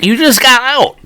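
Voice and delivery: laughing mock plea. Joking plea to O J.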